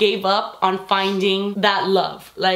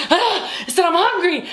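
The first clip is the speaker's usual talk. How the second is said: mock going off; 8 semitones higher than usual. Pregnant woman to her partner, mock going off. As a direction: playfully act out a hungry tantrum. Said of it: "I'll, like, go off on him".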